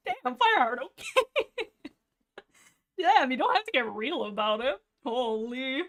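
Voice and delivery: high, loud, laughing protest; hammed-up reaction to a savage comeback; mock taken aback. Kid answers Fireheart's harsh comeback, mock taken aback.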